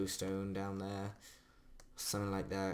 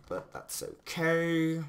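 sing-song reassurance; 7 semitones higher, 6 dB louder, much slower than usual. Real, not acted. Reassures self after near fall.

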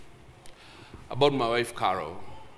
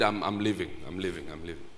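fading softer and lower; quiet mumble; 10 semitones lower, 7 dB quieter, faster than usual. Retold or imitated. Bishop leaving the breakfast meeting, quiet fading mumble. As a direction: slip out, mumbling under your breath.

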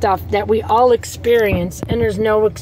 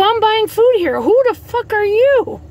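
indignant retort; 10 semitones higher than usual. Retold or imitated. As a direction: throw it back at her, offended. Retold comeback again.

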